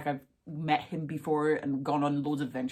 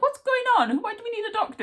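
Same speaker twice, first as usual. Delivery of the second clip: very high, loud mock-panicked mum; shrill mum; 5 dB louder than usual. Cherry's mum demanding answers, very high and flustered. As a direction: squeak it like a flustered mother. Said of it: "when Cherry's mum's like".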